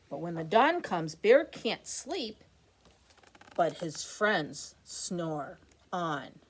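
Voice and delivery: put-on lively sing-song read-aloud for kids; picture book reading. A narrator's reading. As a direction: read it like a bedtime rhyme.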